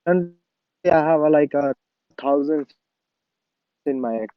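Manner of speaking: choppy connection cutting out; breaking up space radio. Real, not acted. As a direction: voice dropping out over bad internet. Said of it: "you're breaking up".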